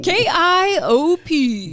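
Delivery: slow, high, loud call letters; news anchor voice. Shown as a demonstration. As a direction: spell it out like a radio anchor. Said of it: "my news anchor voice".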